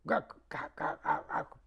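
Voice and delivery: breathy unvoiced clicks like a herder; shepherd's clicking call. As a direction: click your tongue to call the flock. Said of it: "nomás hace un ruidito".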